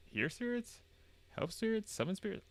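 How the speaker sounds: very high, questioning lilt; puzzled guessing. Real, not acted. Player guessing at garbled game text, high puzzled questions. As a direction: toss out each guess high and quizzical.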